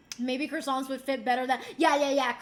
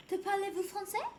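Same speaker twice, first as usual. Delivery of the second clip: French waitress; 5 semitones higher, 4 dB quieter than usual. Retold or imitated. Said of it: "she was like".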